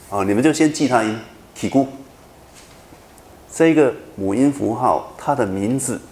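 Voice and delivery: normal talking voice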